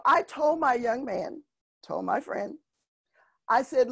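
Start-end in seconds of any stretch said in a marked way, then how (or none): none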